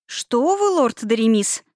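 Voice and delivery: high, cooing polite protest; sugary sweet. A narrator's reading. Placating a displeased lord client, sugary sweet. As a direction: coo it with a sweet smile. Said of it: "сладко улыбнулась Тирель".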